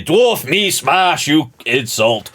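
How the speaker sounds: slow, heavy-worded smash threat; mock brute barbarian. Barbarian readying a punch at dwarf, slow brute voice. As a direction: play a simple brute, word by word.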